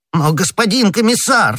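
loud, very high protest; crying out. A narrator's reading. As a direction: cry out in agitated objection. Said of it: "вскричал Бонасье".

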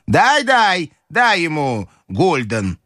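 high, insistent grandmother; настойчивая бабушка. Acted. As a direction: insist in a high old-lady voice. Grandmother insists on giving a coin. (высоко и настойчиво, голосом бабушки)

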